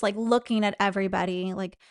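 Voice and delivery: normal talking voice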